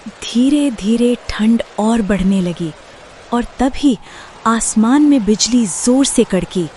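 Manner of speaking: loud, building storyteller voice; dramatic narration. A narrator's reading. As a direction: announce the thunder with weight.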